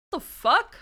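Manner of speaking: loud startled what the fuck; real shock at the screen; shocked outburst. Real, not acted. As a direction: blurt it out in disbelief. Tech trouble outburst on stream.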